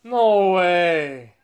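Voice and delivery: loud, drawn-out exclamation of disbelief; stunned and loud; amazed disbelief. Real, not acted. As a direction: call it out loudly, stretched and falling. Reacting to car parking itself.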